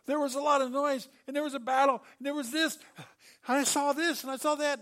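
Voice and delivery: high, loud, frantic babble; frantic messenger. Retold or imitated. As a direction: rattle it off all worked up. Breathless messenger babbling, no message.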